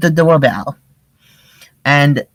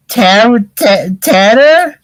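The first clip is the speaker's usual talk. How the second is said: stammering, a touch scared and higher; worked up; 5 semitones higher, 4 dB louder, much slower than usual. Acted. Shaggy stammering to Fred, a touch of mock fright. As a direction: stutter out the word nervously. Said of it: "Shaggy was getting more worked up".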